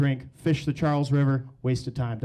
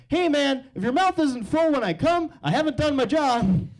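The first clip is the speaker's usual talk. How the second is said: cocky quip; 9 semitones higher than usual. Retold or imitated. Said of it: "So I shot from the hip".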